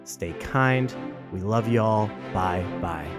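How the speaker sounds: usual speaking voice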